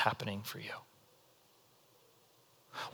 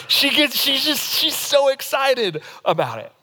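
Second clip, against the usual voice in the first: higher, gleeful telling; wife's glee; 8 semitones higher than usual. Retold or imitated. Describing wife opening gifts, excited and higher. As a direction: bubble over like his wife.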